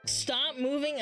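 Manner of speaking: ordinary talk, nothing marked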